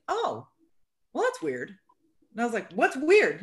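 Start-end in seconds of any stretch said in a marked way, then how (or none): 0.1-1.7 lightly raised, puzzled oh well that's weird; a mild hint of the husband's surprise. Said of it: "And he's like". 2.7-3.4 sharp, defensive snap. Retold or imitated. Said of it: "kind of getting defensive".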